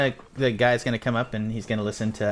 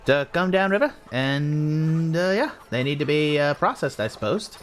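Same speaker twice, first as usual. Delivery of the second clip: higher-pitched character voice; game master playing an official, pitched up; character reporting new arrivals; 6 semitones higher than usual. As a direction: report the arrivals in a raised, lilting voice.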